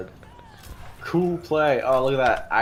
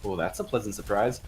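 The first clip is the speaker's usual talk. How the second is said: ordinary talk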